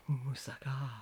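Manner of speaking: soft unvoiced moussaka; whispered moussaka. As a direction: breathe it like a love word. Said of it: "he'd say".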